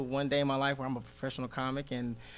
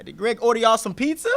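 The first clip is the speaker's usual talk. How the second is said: higher, louder dad voice; imitating dad; 8 semitones higher, 10 dB louder than usual. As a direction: play the suspicious Nigerian dad. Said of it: "my dad always painted me as the bad guy".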